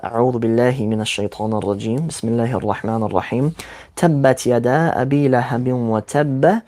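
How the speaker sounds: fast, flowing Quranic recitation. Acted. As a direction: recite the verses swiftly in Arabic.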